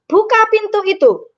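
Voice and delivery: high-pitched order on "Buka pintu itu"; commanding intonation. Shown as a demonstration. Teacher demonstrating how we give orders, commanding tone. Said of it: "intonasinya ya memang intonasi menyuruh".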